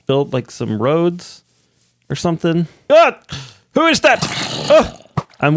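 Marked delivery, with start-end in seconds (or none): 2.9-3.2 sudden high shriek of fright; short high scream, caught off guard; startled yelp. Real, not acted. Sudden mob appears in cave. 3.7-4.2 high, alarmed question; startled. Real, not acted. Sudden mob appears in cave. 4.2-5.0 breathy gasp. Real, not acted. Sudden mob appears in cave.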